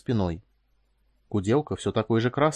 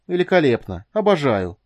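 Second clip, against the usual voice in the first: loud, higher, exasperated exclamations; furious sarcasm; 4 semitones higher, 6 dB louder than usual. A narrator's reading. Wife fuming inwardly on the way to her husband, furious. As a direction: spit out two bitter mock cheers. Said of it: "подумала она с яростью".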